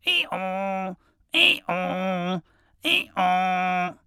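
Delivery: loud, high hee-haw braying; donkey bray. Acted. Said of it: "he brayed".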